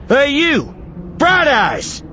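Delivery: high-pitched yelled challenge; taunting shout. A narrator's reading. Eli calls the attackers to face him, shouting. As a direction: yell out to draw them over. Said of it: "he shouted".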